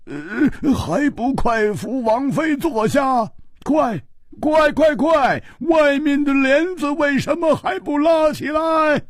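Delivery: very high, frantic character voice, orders tumbling out; urgent fussing king. A narrator's reading. King orders servants to seat his queen, urgent and fussing. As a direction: fuss in a high, anxious voice, repeating the urgent words. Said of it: "一连声地说".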